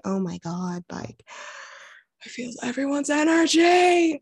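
quiet gasp climbing into a high squeal; retold overwhelm. Guest recalling her first yoga retreat, retold overwhelm. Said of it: "I was like".

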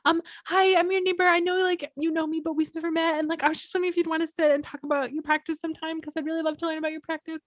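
high-pitched, sing-song friendly introduction; eager polite newcomer. Retold or imitated. Fan introducing herself to Zen neighbor, eagerly polite. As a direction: pitch it way up, quick and polite toward the end. Retelling her nervous hello to neighbor.

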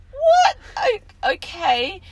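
high, loud startled what then a gulping okay; shocked disbelief. Retold or imitated. Mum told to bring a stool sample, shocked. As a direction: recoil in horror, then give in. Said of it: "And I'm, like, going".

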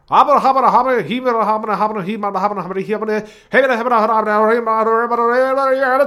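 giddy gibberish burst; excited gibberish. Real, not acted. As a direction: babble like a hyped radio host. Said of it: "I'm just a little bit excited about broadcasting".

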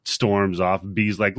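usual speaking voice

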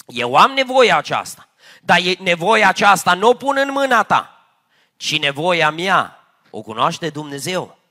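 loud, fervent preaching, driving each phrase home; preaching at full force. Acted. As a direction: proclaim it to the whole congregation. Lord's answer, preached loudly.

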